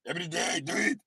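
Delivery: put-on taunting Austrian-accented action hero; mock Austrian accent, playful boast; Arnold Schwarzenegger accent. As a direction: tease them in a Schwarzenegger accent. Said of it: "doing an Arnold Schwarzenegger accent".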